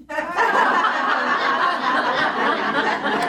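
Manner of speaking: high, breathy laugh at his own punchline; delighted chuckle after the punchline; own joke laugh. Real, not acted.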